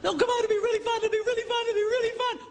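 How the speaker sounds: very high, squeaky mock begging; hammed-up wheedle in a tiny high voice; mock pleading. Spouse begging partner to join in, squeaky mock pleading. Mocks the pleading, repeated insistently.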